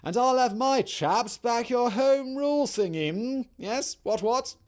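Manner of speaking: high, loud toff voice, very plummy; posh old-fashioned Englishman. Retold or imitated. Asquith sweet-talks the Irish party leader in a hammed-up posh toff voice. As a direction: ham up an upper-class English gent, what what. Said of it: "and basically said".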